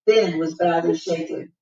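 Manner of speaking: usual speaking voice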